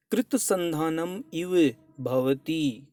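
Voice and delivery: कृत संधानम इव भवति drawn out word by word at a slow pace, कृत stressed; slow, deliberate recitation. Shown as a demonstration. Teacher slowly spelling out the verse for students, slow.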